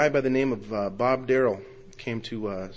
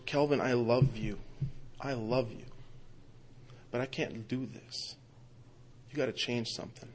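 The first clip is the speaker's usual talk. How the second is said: pained quiet plea; 7 dB quieter than usual. Retold or imitated. Wife telling her husband he must change, quietly pained. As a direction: imitate a wife pleading quietly, with heavy pauses.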